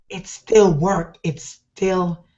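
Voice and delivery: ordinary delivery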